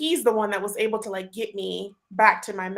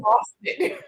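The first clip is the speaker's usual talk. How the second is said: laughing; 7 semitones higher than usual. Real, not acted. Laughing while recounting panic.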